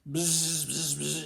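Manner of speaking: loud angry mosquito buzz. Acted. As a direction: buzz it out furious. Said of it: "he goes".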